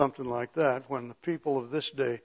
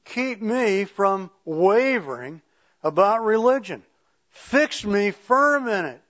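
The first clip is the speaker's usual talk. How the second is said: slow, loud, higher-pitched plea; emphatic prayer reading; 5 semitones higher, 6 dB louder, slower than usual. Acted. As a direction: pray it out slowly and forcefully.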